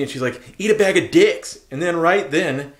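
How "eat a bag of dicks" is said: higher mimicry of her jeer; retold insult. Woman in line insulting him, mimicked jeer. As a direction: toss it out as her taunt.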